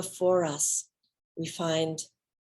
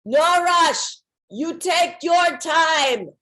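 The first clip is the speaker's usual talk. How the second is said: high, loud, insistent reassurance; Swami telling others; 10 semitones higher, 7 dB louder, much slower than usual. Retold or imitated. The Swami tells the others not to rush her, loud and high. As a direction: tell the group firmly to wait for her. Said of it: "I hear him telling others".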